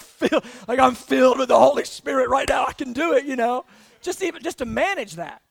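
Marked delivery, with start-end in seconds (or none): 0.0-3.2 hammed-up, loud holy boast; mock pumped up. Believer fresh from hours of prayer, mock pumped-up shout. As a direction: puff yourself up, half joking.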